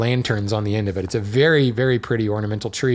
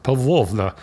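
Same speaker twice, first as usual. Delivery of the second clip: Pavlovna with a long Russian O; playful Russian pronunciation of Pavlovna; Russian accent. Shown as a demonstration. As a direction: put on your best Russian accent for the name. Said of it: "that was my best Russian accent".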